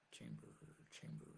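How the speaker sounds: soft whispered fading echo; echo effect. Pretend. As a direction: whisper the word back like an echo. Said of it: "my own echo chamber".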